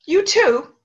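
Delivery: high, surprised little you too; mom's high you too. Retold or imitated. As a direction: lift it high and bright. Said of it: "And then she said".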